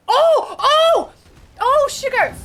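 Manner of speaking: high loud shocked cries; jump-scared yelp; startled shriek. Real, not acted. Startled by game creature.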